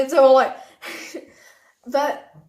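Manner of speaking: laughing through embarrassment; nervous laugh. Real, not acted. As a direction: burst of laughter, half crying.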